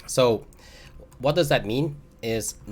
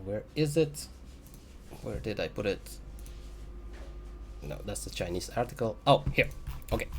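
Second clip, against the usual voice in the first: muttering while searching; 8 dB quieter than usual. Real, not acted. Searching for a document on screen.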